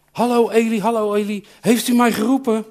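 put-on an eager young boy just woken; lightly voiced eager little boy. Boy Samuel runs to Eli.

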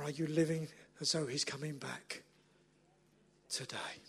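barely voiced, low single word; hushed whisper. Real, not acted. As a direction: let the last word sink to a whisper. Hushed final question to congregation.

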